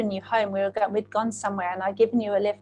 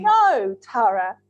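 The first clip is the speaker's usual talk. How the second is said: emphatic protest; 8 dB louder, much slower than usual. Retold or imitated. Friend refusing to be brushed off, retold protest. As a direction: wail the no, then drop on the name.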